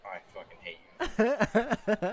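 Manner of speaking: slow, high, laughing mock hatred; laughing mock hate. Real, not acted. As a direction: drawl it out laughing. Playful anger at dice.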